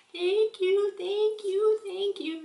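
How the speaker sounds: put-on high, loud delighted little-girl thanks; grateful toddler. Mom imitating her daughter thanking her for a cookie. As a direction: gush thank you like a thrilled toddler.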